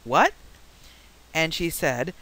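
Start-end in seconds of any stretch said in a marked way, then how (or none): 0.0-0.3 imitating a baffled listener, sharply rising; short "What?" swooping up; puzzled question. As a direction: ask "What?" as if you didn't understand. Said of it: "and then finally someone went".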